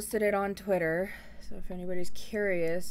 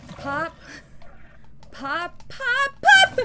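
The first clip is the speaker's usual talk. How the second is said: much higher, much louder; excited yell; 12 dB louder, much slower than usual. Real, not acted. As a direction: shout it out gleefully. Excited cheering.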